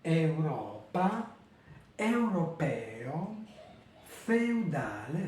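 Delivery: slow Italian Europa, europeo, feudale; careful teacher Italian; Italian diphthongs. Shown as a demonstration. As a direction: model each word slowly, eu joined. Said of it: "Et ça c'est pareil".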